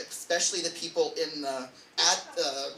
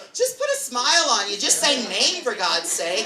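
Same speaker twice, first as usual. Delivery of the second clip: exasperated; 6 semitones higher, 6 dB louder than usual. Retold or imitated. Exasperated bar crowd at pianist.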